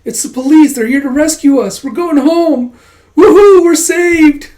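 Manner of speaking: put-on high, loud, elated kids' cheers; cheering kids. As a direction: build to a shrieking whoop of relief. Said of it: "all the kids going".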